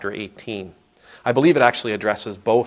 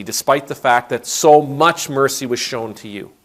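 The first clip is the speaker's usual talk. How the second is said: angry master; 3 semitones higher, 6 dB louder than usual. Retold or imitated. Angry master condemns servant.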